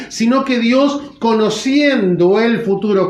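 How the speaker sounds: usual speaking voice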